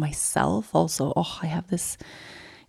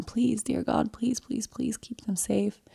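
pleading prayer; 4 semitones higher, 6 dB quieter than usual. Retold or imitated. Retold prayer for the women.

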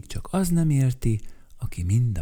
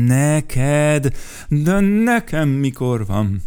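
higher, louder indignant retort; 5 semitones higher, 6 dB louder, much slower than usual. Acted. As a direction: throw the question back, offended. Vacska Mati indignant reply. (felháborodott, hangosabb visszakérdezés)

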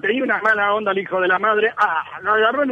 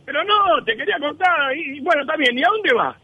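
a lightly voiced, higher grumpy barber; 6 semitones higher than usual. Barber griping at the client, a higher, grumpy voice. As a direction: play the sour barber, pitched up.

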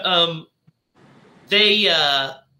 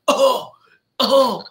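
high mock strangled groan; mock choking groan; 9 semitones higher, 3 dB louder than usual. Father being choked by voodoo, mock groan. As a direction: gasp it out like you're choking.

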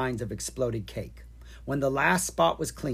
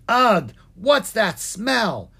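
loud, high groan of disgust; 8 semitones higher, 8 dB louder, much slower than usual. A narrator's reading. Dragon arriving by a smelly shop, disgusted. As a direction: recoil loudly, stretching out the words.

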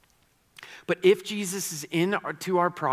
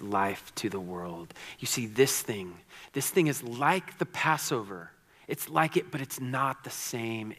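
lower, measured scripture reading; solemn lower voice of Jesus; reading Jesus's words; 4 semitones lower than usual. Acted.

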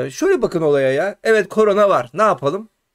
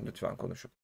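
soft whisper, almost breath; whispered plea; 8 semitones lower, 19 dB quieter than usual. Real, not acted. Host pleads with viewers to speak English, whispering. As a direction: beg them gently under your breath.

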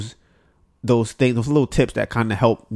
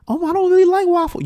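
put-on much higher girlish voice for her reply; mock girl voice on the phone; imitated girl. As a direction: squeak out her answer like the girl.

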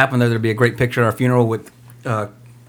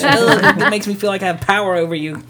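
smug wife voice; 7 semitones higher than usual. Pretend. Mocking wife's smug tone.

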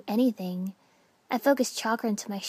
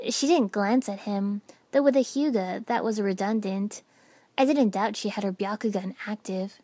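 ordinary delivery

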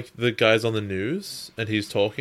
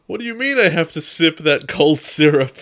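character voice; 6 semitones higher, 7 dB louder than usual. Pretend. Voicing Tai reading phone.

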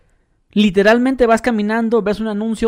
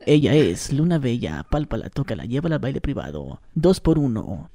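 very low, modified announcer voice; deep club MC sales patter; strip-club DJ; 6 semitones lower than usual. Shown as a demonstration. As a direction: drop the pitch right down and draw out the promo. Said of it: "que te modifican la voz".

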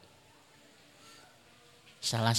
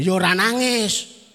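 loud, higher-pitched comic outburst; exasperated punchline, raised voice; mock exasperation; 7 semitones higher, 9 dB louder than usual. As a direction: blurt it out, fed up with trying. Acted failure to cry while praying.

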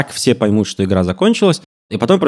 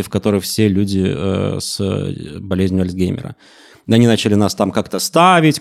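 normal talking voice

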